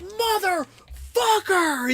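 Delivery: very high, drawn-out mock rage scream; retold snapping. Streamer re-enacts raging at the game, high drawn-out yell. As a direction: act out losing it, stretching the word. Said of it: "I was snapping".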